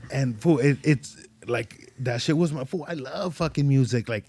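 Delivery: ordinary talk, nothing marked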